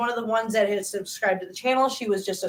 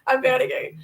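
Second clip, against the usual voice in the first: higher, louder mock-overwhelmed rush; retold tearful panic; 4 semitones higher, 4 dB louder than usual. Creator retelling her reply to an offer of help, mock tearful panic. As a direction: replay being overwhelmed, voice lifted, no real tears. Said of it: "I'm, like, in tears going".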